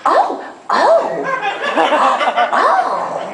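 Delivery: high, rising oohs of delight; pleasured moans. Retold or imitated. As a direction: let the oh climb with pleasure. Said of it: "I'm actually saying".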